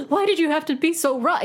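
high-pitched melodramatic complaint; mock dismay. Podcaster mocking Laurel's reaction, mock dismay. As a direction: imitate an over-wrought heroine.

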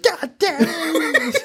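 high, very drawn-out mock exasperation; hammed-up high-pitched wail; Sherry Moon Zombie. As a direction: imitate an actress whining a curse, stretched out. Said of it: "And Sherry Moon's like".

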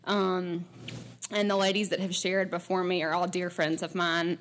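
usual speaking voice

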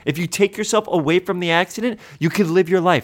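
high, pressing scolding; exasperated pep talk. Acted. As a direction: urge him hard to move on. Heated lecture to friend.

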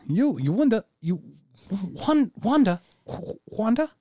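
high, baffled repeating of wonder; baffled high echo. Acted. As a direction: echo it back, utterly baffled. Puzzled echo of the deep question.

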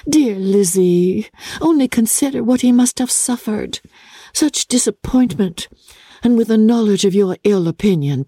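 lightly voiced gentle sister; Jane's voice. Jane distressed over Darcy.

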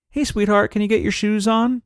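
high, sweet, coaxing request; nicest voice. Acted. Parent asking child to get shoes on, nicest voice. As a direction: ask in your sweetest parent voice. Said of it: "in your nicest voice".

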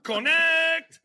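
high, drawn-out cheer. Acted. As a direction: belt the company name like a crowd. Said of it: "do the cheer".

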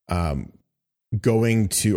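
usual speaking voice

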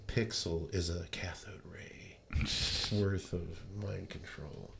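slow, hushed mock-paranoid whisper; conspiratorial whisper. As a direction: whisper it like a secret plot, drawn out. Mock conspiracy about TV programming.

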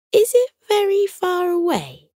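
put-on high, curious boy voice; lightly voiced young boy asking; Bo's voice. A narrator's reading. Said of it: "he asked Tilly".

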